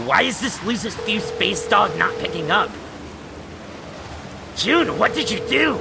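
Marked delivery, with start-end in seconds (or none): 4.6-5.8 higher, sharp alarmed question. Acted. Terezi realizing what June has done, alarmed. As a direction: panic breaking through.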